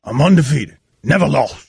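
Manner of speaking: bragging low-voiced sports dad; LaVar Ball impression. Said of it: "[name]'s fake LaVar Ball".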